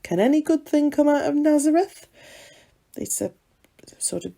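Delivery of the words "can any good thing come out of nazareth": high, louder scornful jibe at Nazareth; mocking saying. Servant girl quoting a local saying, scornful. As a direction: sneer it like an old village joke. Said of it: "We even have a saying where I come from".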